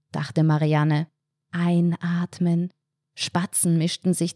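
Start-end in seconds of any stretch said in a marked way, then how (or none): 1.5-2.7 slow, monotone relaxation instruction; breathing-exercise cue. Acted. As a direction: stretch it out evenly, like a relaxation coach. Entspannte Atemübung im Kopf angesagt. (langsam und monoton, wie bei einer Atemübung)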